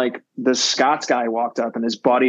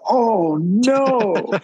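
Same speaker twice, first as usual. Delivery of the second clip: high, dismayed "oh no"; mock dismay; 9 semitones higher than usual. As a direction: voice the brother realizing the mistake. Brother pulls out melted plastic.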